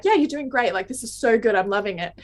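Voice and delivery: normal talking voice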